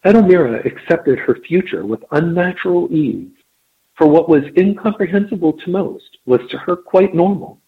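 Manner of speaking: measured storytelling read; story narration. A narrator's reading. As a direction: read it like an audiobook. Reads the story aloud.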